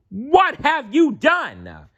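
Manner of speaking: high, slow squeaky gnome voice; high slow outraged squeak; angry old gnome. A narrator's reading. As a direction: scold like a cranky old gnome. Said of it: "shouted Crooked Root angrily".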